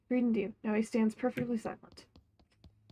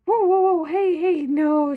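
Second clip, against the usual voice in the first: panicked shout; 3 semitones higher, 9 dB louder than usual. Acted. Panicked at fire.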